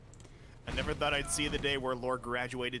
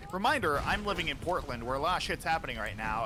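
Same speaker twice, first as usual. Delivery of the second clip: usual speaking voice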